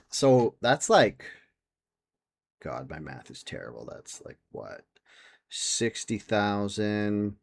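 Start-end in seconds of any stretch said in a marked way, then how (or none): 2.6-4.8 much softer, trailing into a breathy aside; muttering to herself. Real, not acted. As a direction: grumble under your breath at your own math. Muttering to self over math.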